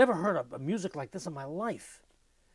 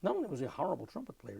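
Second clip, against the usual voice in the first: ordinary delivery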